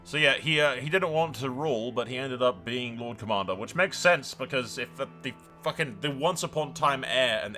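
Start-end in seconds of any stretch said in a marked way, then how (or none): none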